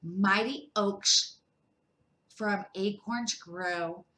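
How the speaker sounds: slow, measured proverb; slow wise saying. Acted. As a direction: let each word land like wisdom. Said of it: "said Badger".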